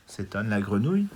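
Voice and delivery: plain reading voice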